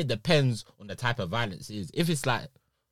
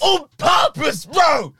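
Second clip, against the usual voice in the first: much higher, louder, slow emphatic shout; shouted insistence; 10 dB louder, much slower than usual. Real, not acted. Telling his friend it was on purpose, loud. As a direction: shout it out in disbelief.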